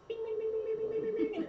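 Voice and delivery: high beeping "bing" sounds; imitated alert beeps. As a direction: chime like a TV map alert.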